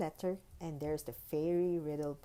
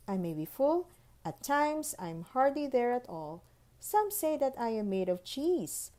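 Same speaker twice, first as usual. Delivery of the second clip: higher, playful riddle-book reading; 5 semitones higher, 5 dB louder than usual. Acted. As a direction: tease each riddle, then reveal.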